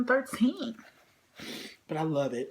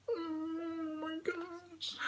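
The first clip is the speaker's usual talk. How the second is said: overcome; 7 semitones higher, 12 dB quieter, much slower than usual. Real, not acted. Overcome, fading.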